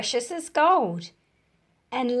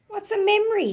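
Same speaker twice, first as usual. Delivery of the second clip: put-on lightly raised, childlike question; higher, eager child asking; small boy's voice; 10 semitones higher, 4 dB louder, much faster than usual. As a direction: ask it like a curious little boy. Small boy asks old people.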